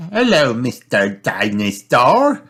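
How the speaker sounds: slow sing-song hello, lifting at the end; Peppa. Acted. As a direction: greet a toy in a drawn-out child's voice. Peppa greets mended toy.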